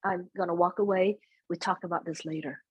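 soft, quiet refusal to argue; quiet de-escalation. Retold or imitated. Daughter backing away from someone who is yelling, quietly. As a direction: drop your voice and calmly step back from conflict. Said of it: "be quiet and I say".

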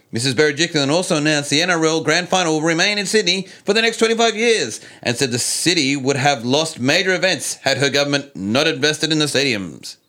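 high, bright news-anchor delivery; reporter voice. Pretend. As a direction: read it like a TV reporter. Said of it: "reporter voice".

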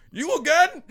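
put-on high, loud exasperated old Italian man; old Italian pizza man. Pizza man shouts at the thief who came back, high and exasperated. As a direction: bark it at a returning pest. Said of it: "It's a fucking old Italian guy".

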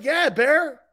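high, loud confirmation; emphatic retold yes. Pal assuring grandfather about the player, emphatic yes. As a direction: call it back with eager certainty. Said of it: "He's like".

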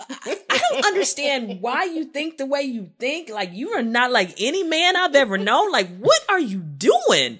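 animated, disbelieving questioning; exasperated bafflement. Retold or imitated. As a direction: confront a partner who baffles you. Acting out her bewilderment at him.

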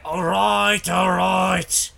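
high, loud, breaking goblin; pained goblin voice. Acted. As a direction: give in like a hurt goblin. Goblin gives in after the kick.

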